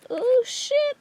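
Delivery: high, startled gasp of delight; surprised squeal. Real, not acted. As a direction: yelp it out in surprise. Surprised by the transfer result.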